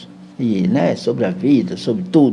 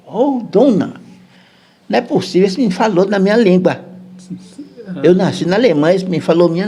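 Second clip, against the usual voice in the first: higher, excited priest voice; a priest who can't believe his ears; amazed priest; 6 semitones higher than usual. Retold or imitated. German priest amazed at boy.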